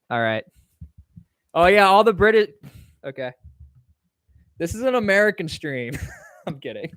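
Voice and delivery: normal talking voice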